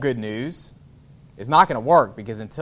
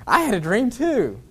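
much higher, hammed-up eager baker; 12 semitones higher, 4 dB louder than usual. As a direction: squeak it out like the hopeful baker. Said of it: "He's like".

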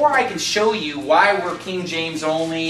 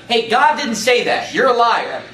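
higher-pitched, forceful pulpit rebuke; preaching rebuke; 5 semitones higher, 4 dB louder than usual. Real, not acted. Preacher answering signs that misquote God, indignant rebuke. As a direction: call out the liar from the pulpit.

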